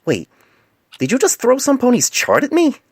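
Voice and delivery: outraged he was hit; indignant disbelief. A narrator's reading. Painless to the mare who threw a chart, indignant cry.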